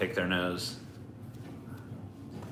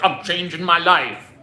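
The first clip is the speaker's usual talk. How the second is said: higher, slow, grandly earnest vow; mock resolute vow; 9 semitones higher, 6 dB louder, slower than usual. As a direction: overblown new-year resolution. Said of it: "I'm like".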